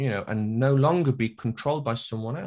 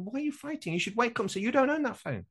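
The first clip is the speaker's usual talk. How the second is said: mock exasperated plea; 9 semitones higher, 4 dB quieter, faster than usual. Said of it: "I go".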